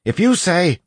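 high, loud call, cut off. A narrator's reading. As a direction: call out a warning into the room. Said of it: "Breton called in".

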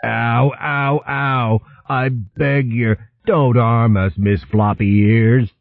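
a hint of a cringing weasel; whimpering weasel. A narrator's reading. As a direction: beg for mercy, lightly voiced. Dotty mocking cowering weasel.

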